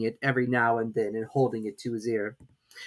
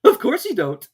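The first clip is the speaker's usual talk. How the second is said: higher, louder scorn; contemptuous Hatter; 11 semitones higher, 9 dB louder than usual. A narrator's reading. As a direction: look down on her. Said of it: "tossing his head contemptuously".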